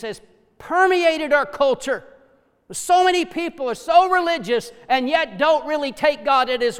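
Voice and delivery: loud fervent preaching; preacher decrying unbelief, loud. Acted. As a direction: thunder it from the pulpit. Preaching at full force.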